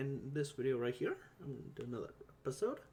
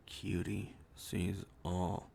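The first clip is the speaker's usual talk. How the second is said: much lower, very slow mock-ominous oracle; deep slow oracle voice; 6 semitones lower, much slower than usual. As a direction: stretch it out low like a hammed-up seer. Reworks oracle catchphrase lower.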